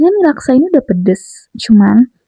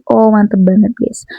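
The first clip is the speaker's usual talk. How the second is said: ordinary delivery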